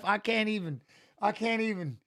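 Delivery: normal talking voice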